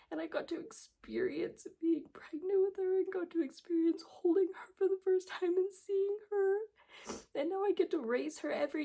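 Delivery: holding back tears while remembering her daughter; emotional, near tears. Real, not acted. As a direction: voice lifted high with real emotion, on the edge of crying. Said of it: "I was such an emotional mess".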